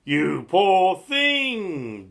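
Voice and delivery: loud, slow boom. A narrator's reading. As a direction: boom it out like a kindly giantess. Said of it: "boomed the giantess".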